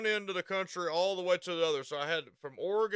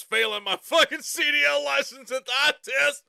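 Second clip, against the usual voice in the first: self-mocking shout; 8 semitones higher, 8 dB louder than usual. Real, not acted. Laughing at own failed eye test.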